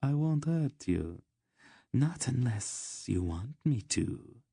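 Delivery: silky, teasing promise; purring. Acted. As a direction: lure them in, drawing out the ends. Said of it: "she purred at him".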